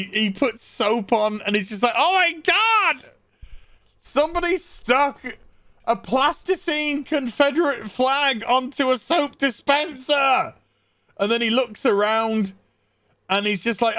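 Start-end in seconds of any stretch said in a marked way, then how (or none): 2.0-10.5 high-pitched hammed-up shock; horrified disbelief. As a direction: wail it out as a mock-aghast discovery. Acting husband's horrified discovery.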